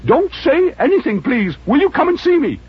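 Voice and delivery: put-on high, thin elderly voice; old Scrooge voice. Scrooge begging the charity gentleman, high old voice.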